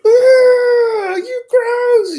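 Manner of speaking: put-on very high, loud squealing kids; disgusted kid squeal. Classmates seeing snot in his hair, loud squeal. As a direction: shriek it like grossed-out kids. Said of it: "all the kids like".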